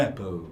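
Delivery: "apple" with a nasalised A; English "apple", opening on a nasal A. Shown as a demonstration. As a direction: say "apple" as the correct model, its A through the nose. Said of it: "é um A nasalado".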